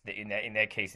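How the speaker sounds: ordinary talk, nothing marked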